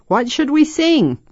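put-on loud boy shouting back. A narrator's reading. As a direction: call the question over the noise. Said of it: "Mark said loudly".

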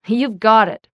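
loud, ready acceptance; punchy, loud promise; emphatic agreement. A narrator's reading. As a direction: agree at full volume. Bill eagerly accepts the terms.

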